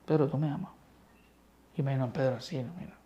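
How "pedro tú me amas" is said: softer, gentle asking; tender question. Acted. Christ gently asking Peter again, softly tender. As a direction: ask it softly, as if to a friend who failed.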